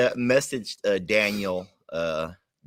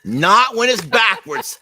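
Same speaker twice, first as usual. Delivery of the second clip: higher, louder, slow echo of the joke; amused loud repeat; 6 semitones higher, 8 dB louder, slower than usual. Acted.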